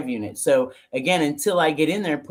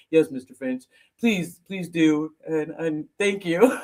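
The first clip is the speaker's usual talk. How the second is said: deferential husband; slower than usual. Retold or imitated. Husband suddenly deferential to Ron.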